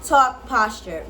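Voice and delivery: ordinary talk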